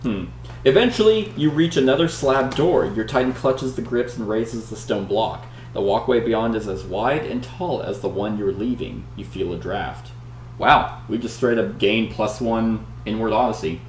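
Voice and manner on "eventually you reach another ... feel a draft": dramatic read-aloud narration; story narration. Acted. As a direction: read it like an adventure gamebook. Reads the gamebook story aloud.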